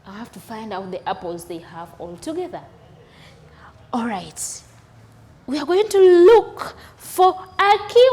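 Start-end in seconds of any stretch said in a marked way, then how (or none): 0.1-2.6 quiet quoted question. Retold or imitated. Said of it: "They're saying".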